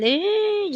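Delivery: sudden high squeal of fright; short high mock shriek; mock scream. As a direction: shriek up high as if the wheel just shook. Retells screaming on the ride.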